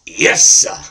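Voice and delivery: loud, booming sidekick affirmation. Shown as a demonstration. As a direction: answer the host with a big burst. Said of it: "hey, Ed".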